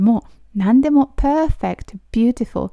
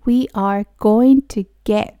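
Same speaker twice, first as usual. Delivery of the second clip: slow, careful teacher pronunciation; slow model reading; much slower than usual. Shown as a demonstration. Teacher reading slowly for students to repeat. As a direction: spell each word out slowly for learners. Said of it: "私と一緒に発音してもらったら".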